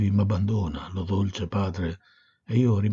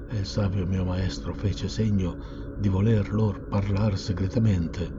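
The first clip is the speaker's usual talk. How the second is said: plain reading voice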